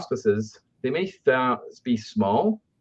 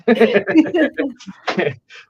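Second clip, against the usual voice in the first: loud high burst of laughter; real laughter; 6 dB louder than usual. Real, not acted. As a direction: laugh out at your own joke. Laughs at own joke.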